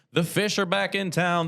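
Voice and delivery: high, punchy announcer hype; excited hype. Real, not acted. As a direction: sell it like a big event. Hyping a big home game.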